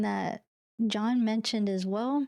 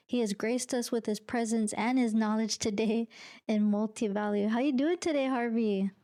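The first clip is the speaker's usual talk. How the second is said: ordinary delivery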